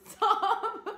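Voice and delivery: high squeal through laughter; laughing. Real, not acted. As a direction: laugh it out, can't stop. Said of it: "She's making me laugh".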